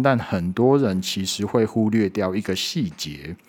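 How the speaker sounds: usual speaking voice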